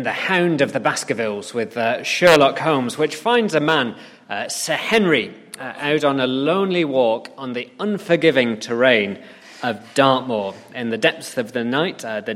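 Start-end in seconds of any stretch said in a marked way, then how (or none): none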